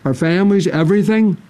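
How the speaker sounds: normal talking voice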